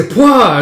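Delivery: loud, high puff of air; bursting gasp. Acted. Boy popping out of a barrel, gasping.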